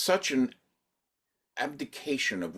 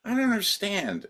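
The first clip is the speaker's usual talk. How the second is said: incredulous; 12 semitones higher than usual. Retold or imitated. Recalling arguing with audiences.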